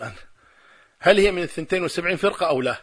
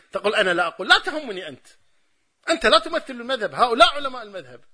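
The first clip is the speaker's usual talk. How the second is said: higher-pitched, insistent retold argument; heated rebuttal; 6 semitones higher, 3 dB louder than usual. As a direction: hammer each point home in a raised pitch. Retelling his heated rebuttal in a debate.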